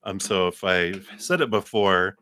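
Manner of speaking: ordinary delivery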